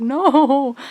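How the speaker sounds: loud dismayed cry of no; reliving a shock about Azriel. Retold or imitated. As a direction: blurt it out in alarm. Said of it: "I was like".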